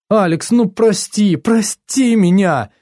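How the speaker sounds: acted crying plea, stretched and high; tearful plea. A narrator's reading. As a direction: beg forgiveness on the edge of tears. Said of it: "Она заплакала".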